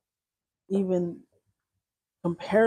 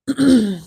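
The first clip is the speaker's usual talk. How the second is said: loud throat clear; 5 semitones higher, 12 dB louder than usual. Real, not acted. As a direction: clear your throat sharply. Throat clearing mid-talk.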